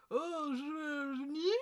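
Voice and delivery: lightly posh voice rising at the end; a hint of a posh boy asking upward; mock posh lilt, question-like rise; posh upward inflection. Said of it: "doing an upward inflection".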